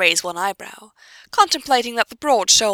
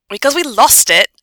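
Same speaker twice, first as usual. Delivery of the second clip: loud, higher-pitched snap; snapped; 5 semitones higher, 8 dB louder than usual. A narrator's reading. Pansy cutting off Hermione's questions, snapping. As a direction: snap the answer back impatiently. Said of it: "Pansy snaps".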